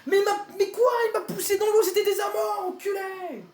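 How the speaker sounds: very high furious yell; raging gamer. Real, not acted. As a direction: explode at the player who pushed him. Pushed into water, rage. (joueur en rage qui hurle très aigu)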